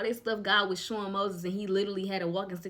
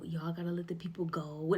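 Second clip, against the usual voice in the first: whispered demand rehearsed under breath; whispering; 5 semitones lower, 9 dB quieter than usual. Retold or imitated. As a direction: whisper it like practicing in your head. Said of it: "He was just playing over in his head".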